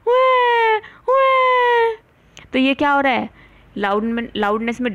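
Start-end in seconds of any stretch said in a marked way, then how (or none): 0.1-2.0 put-on high, loud wailing baby; teacher imitating a loud baby's wail; loud baby cry. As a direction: cry out like a screaming infant. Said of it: "बच्चे देखो कितनी तेज चिल्लाते हैं".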